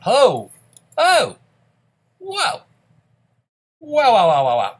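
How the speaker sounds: loud, high cries of surprise; a run of whoas, leaping up high then tumbling down; startled whoas. Real, not acted. Startled exclamations at game event.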